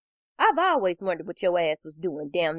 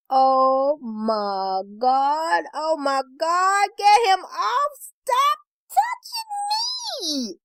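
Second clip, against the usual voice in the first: drawn-out wail climbing into a high shriek; whimpering plea; 7 semitones higher, much slower than usual. A narrator's reading. Woman whimpering to get the licking dog off her, panicked wail. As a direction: beg in rising, crying panic. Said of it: "I whimper".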